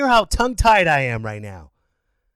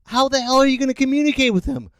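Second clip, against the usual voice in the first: usual speaking voice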